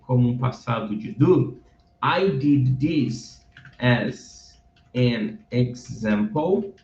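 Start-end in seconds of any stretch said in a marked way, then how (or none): 2.0-6.7 "I did this as an example" read slowly, word by word; slow teacher read. Shown as a demonstration. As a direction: stretch each word out for learners. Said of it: "slow reading demo".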